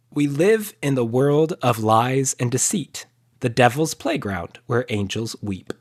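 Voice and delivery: solemn poem recital; dramatic reading. Real, not acted. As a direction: read it like a grand verse. Reading own poem aloud dramatically.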